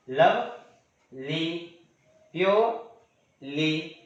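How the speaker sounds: Lovely and purely very slow, each in two beats; split into syllables. Shown as a demonstration. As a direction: pause before the final ली in lovely and purely. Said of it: "दो बार pronounce करने के बाद".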